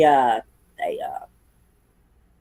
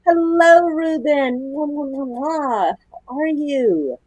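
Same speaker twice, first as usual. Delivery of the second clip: pet voice; 6 semitones higher, much slower than usual. Real, not acted. Greeting a pet with kisses.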